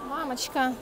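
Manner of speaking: ordinary talk, nothing marked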